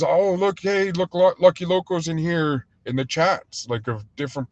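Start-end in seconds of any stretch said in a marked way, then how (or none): 0.0-2.7 higher-pitched excited greeting, retold; Rich D M. Streamer spotting a friend in the chat, mildly excited imitation. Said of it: "he was always".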